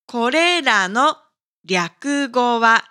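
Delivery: ordinary delivery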